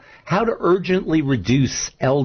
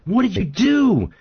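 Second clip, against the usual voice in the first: higher, slow, indignant frog scolding; drowning frog; 8 semitones higher than usual. Acted. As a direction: wail at the scorpion while going under. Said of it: "and he says".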